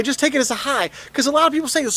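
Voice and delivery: usual speaking voice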